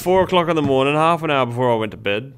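loud, fast mock Yorkshire boast; Yorkshire old man. Quoting Monty Python sketch in boasting Yorkshire accent. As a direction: ham up a bragging old Yorkshireman. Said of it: "Four Yorkshiremen skit from Monty Python".

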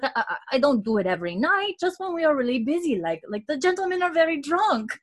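put-on high, defensive French-accented kid; French-accented boy. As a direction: make excuses as a little French boy. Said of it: "starts speaking English with such an accent".

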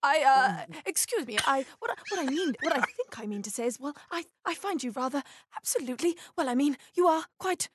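halting, stammering flirt full of false starts; awkward bumbling. Pretend. Panelist chats up the US studio for a bonus point, awkwardly bumbling. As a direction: bumble through a hesitant compliment, restarting often. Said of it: "by being awkwardly bumbling".

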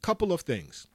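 ordinary delivery

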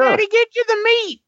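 put-on very high little-kid voice; pretend young son. Son butting into Dad's phone call, high mock child voice. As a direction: pipe up like a child interrupting. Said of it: "my son keeps getting on".

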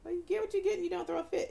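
put-on high, quick singsong chant to a child; sing-song to a kid. Shown as a demonstration. Mother reciting the rule to a kid in a singsong. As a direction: chant it like scolding a toddler. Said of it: "what you tell the kid".

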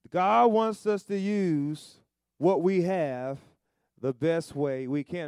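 loud, slow, drawn-out preaching; slow, loud preacher's crescendo; preaching climax. Acted. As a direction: build to the peak and hold it.

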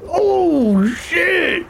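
high startled yelp of alarm; shocked shout. Real, not acted. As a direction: cry out in shock. Party member nearly dead.

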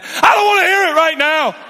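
acted harried dad shouting; angry parent outburst. Acted parent cutting off child.

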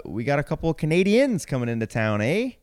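hammed-up Canadian with a high rising "eh"; Canadian accent. Host teasing about Canadian teams coming to town, mock Canadian accent. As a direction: lay on a mock Canadian accent, climbing at the end. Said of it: "Can you do a Canadian accent".

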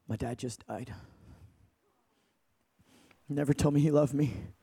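low, hushed, halting confession; quiet grief. Retold or imitated. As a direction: say it softly and low, with long pauses. Grieving girl reveals father's death.

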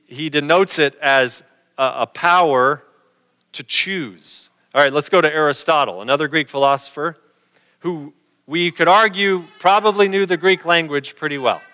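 ordinary delivery

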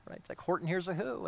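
ordinary talk

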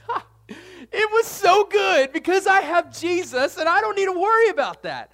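hammed-up carefree joy; giddy relieved believer. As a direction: laugh it off like nothing matters now. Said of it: "You'll be like".

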